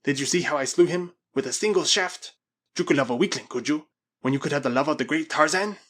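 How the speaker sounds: lightly voiced, self-glorifying Spaniard; boastful madman. Spaniard boasts of the slaying to the girl, boastful. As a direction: brag with emphatic, rising force.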